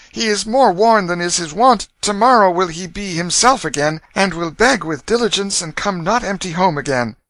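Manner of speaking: high-pitched daughter reasoning anxiously; pleading girl. A narrator's reading. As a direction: plead a brother's case to father. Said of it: "began to plead timidly for their brother".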